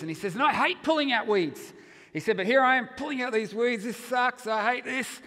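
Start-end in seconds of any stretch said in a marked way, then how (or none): 0.3-1.7 mock grumbling, louder and higher; grumpy complaint. Retold grouch complaining in the garden. 2.4-5.2 louder, higher mock griping; fed-up grouch. Retold grouch fed up with weeding.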